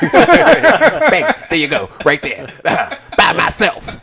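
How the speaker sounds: high laughing playful voice. Pretend. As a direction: giggle through the joke. Laughing riffing in put-on voice.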